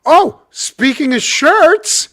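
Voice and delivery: high, loud and slow, milking the segue; mock sly segue. Real, not acted. Host teasing co-host about shirts, slow high playful drawl. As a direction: draw it out slyly with a high loud voice.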